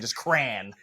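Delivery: "cran" as one syllable with no E; clipped crayon pronunciation. Shown as a demonstration. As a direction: crayon cut down to "cran", rhyming with van. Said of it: "not even the E at the end".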